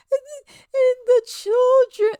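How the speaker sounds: loud, high-pitched fake bawling; hammed-up wail, stammering through the sobs; mock crying. Mimicking a singer weeping over the children, mock bawling. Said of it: "film herself fake crying".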